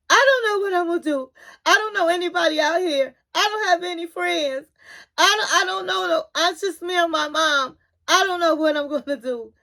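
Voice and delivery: high, fast, tearful wail of a retold breakdown; imitating crying. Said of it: "She's crying".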